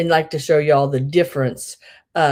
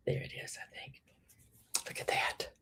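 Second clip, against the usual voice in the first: hushed whisper to herself; 18 dB quieter than usual. Real, not acted. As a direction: breathe it under your breath. Whispered to self.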